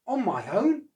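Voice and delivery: can't believe he's in charge; gasping surprise. Acted. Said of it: "gasped Oscar".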